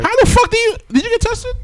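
high-pitched, alarmed disbelief; incredulous outburst. Real, not acted. Co-host confronting friend about his throat scare, incredulous. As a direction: demand it in a high, incredulous squeal. Mock outrage at friend withholding symptoms.

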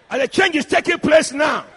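loud, forceful preacher's proclamation; emphatic declaration. Real, not acted. Preacher declaring change to husbands in church, emphatic declaration.

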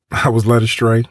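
ordinary delivery

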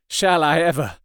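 usual speaking voice

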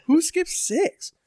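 very high, slow incredulous question. Real, not acted. Mocking the cheer mom, slow very high disbelief. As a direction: stretch it out, high and baffled.

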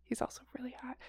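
soft breathy whisper; swooning whisper. Real, not acted. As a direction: whisper it like a secret crush. Swooning over actor.

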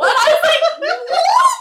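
very high, loud laughing shout; a stunned squeal through laughter; laughing, high pitched outrage; shocked. Real, not acted. Shocked at being blamed.

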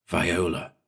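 softly calling her name. A narrator's reading. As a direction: wake her gently with her name. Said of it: "I called softly".

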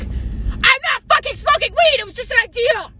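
retelling a TV fight scene, shouting the character's denial; Zendaya losing it. Retold or imitated. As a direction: play the freak-out big, mock-shouting. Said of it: "Zendaya was like".

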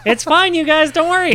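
high, laughing, ironic calm; laughing mock reassurance. Real, not acted. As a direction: laugh through a fake reassurance. Joking while the forest burns.

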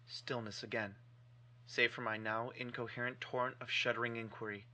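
ordinary delivery

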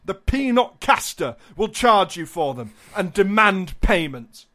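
grand, rising storyteller delivery; theatrical relish on every villainous detail; mock-dramatic narration. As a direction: declaim it like a dire warning. Reads scam description dramatically.

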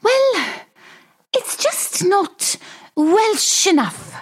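usual speaking voice

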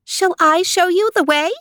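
high-pitched little mouse voice. A narrator's reading. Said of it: "The mouse said".

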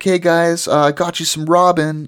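high, chirpy greeting voice; bright friendly dude calling to neighbors; cheerful bro. Retold or imitated. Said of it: "and he's like".

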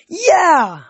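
high, loud whoop of joy; exhilarated yell. A narrator's reading. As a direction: yell it out in the thrill of flying. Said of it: "I yelled".

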